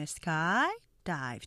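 "sky dive" with long SKY, weaker dive; stress on SKY. Shown as a demonstration. Teacher explaining accent to learners, "sky dive" stressed on SKY. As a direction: stretch SKY, then drop off on dive. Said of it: "앞에를 액센트 강조해야".

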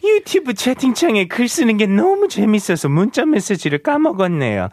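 animated, fast, high-pitched read-out; listener message read. Acted. Radio host reading a listener's chat message, animated high read-out. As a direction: perform the message brightly and quickly, pitch swooping up.

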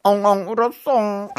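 high, fast hammed-up crying; mock bawling. Host asks him to do his ong ong again, hammed-up crying. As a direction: bawl it like a baby on request. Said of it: "can you do your ongong one more time".

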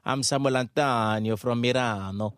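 put-on stagey Italian accent, savouring the names. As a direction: introduce yourself as a hammed-up Italian. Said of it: "Let me see your Italian accent".

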